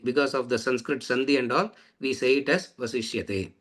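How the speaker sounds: usual speaking voice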